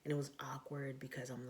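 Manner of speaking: ordinary delivery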